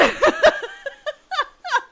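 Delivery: high burst of laughter; real laughter after ranting; loud incredulous laugh. Real, not acted.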